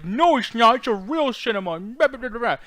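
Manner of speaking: high, loud mock-indignant fan voice. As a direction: whine it like an outraged fanboy. Mocking grown comic-movie fans.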